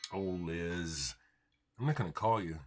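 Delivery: usual speaking voice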